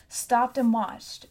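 ordinary delivery

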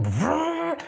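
high mock engine rev noise; engine vroom. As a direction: make the car sound as you floor it. Imitating car revving off.

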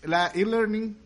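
high mock Australian accent. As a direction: say it like an Aussie. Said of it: "tenemos acento australiano".

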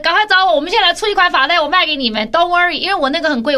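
high, loud rallying pitch; excited sales call. Real, not acted. Host recruits headband makers from viewers, excited urgent call.

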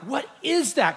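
high-pitched, alarmed disbelief; freaking out. Retold or imitated. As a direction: re-enact panic at a strange sight. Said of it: "I'm freaking out".

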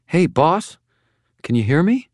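put-on high, anxious young woman; a young woman checking if he hears her; Molly, worried. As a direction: call to him, worried and high. Molly checking on hurt Harry.

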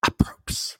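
approps breathed out unvoiced; teasing whisper of approps. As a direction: whisper it like a secret. Repeating approps to annoy.

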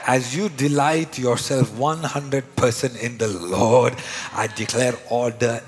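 preacher shouting declarations over the congregation; loud declaration. Real, not acted. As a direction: proclaim each line like a decree.

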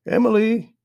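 high, loud sustained call. A narrator's reading. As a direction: call up the stairs for someone. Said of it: "grandmother called from downstairs".

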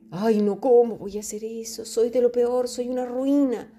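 hammed-up guilty lament, pitch climbing; mock self-pity. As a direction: wail in exaggerated self-reproach. Mocks a guilt-ridden self-blamer.

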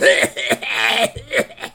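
high, airless wheezy laugh-cough; imitated old-person cough. Imitating an old couple's belly laugh becoming coughs. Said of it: "I am just trying to imitate old people's coughs".

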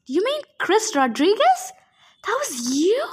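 high, incredulous questions soaring at the end; shocked gasp. A narrator's reading. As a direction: react in stunned disbelief. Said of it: "Annabeth gasped".

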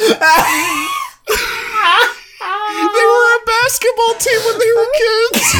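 high, loud laughing yell; laughing shout. Real, not acted. Laughing disbelief.

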